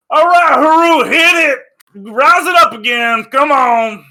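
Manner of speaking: high, loud shouted command to the crew; captain's shouted order. Acted. Captain yelling at his crew to lift off, loud shout. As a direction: bellow the order to launch the ship. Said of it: "he kinda goes".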